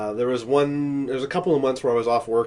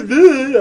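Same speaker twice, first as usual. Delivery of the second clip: much higher, louder blah; mock blah; 12 semitones higher, 11 dB louder than usual. Teasing a friend's voice, mock blah. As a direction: squawk a mocking blah. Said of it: "That's what you sound like".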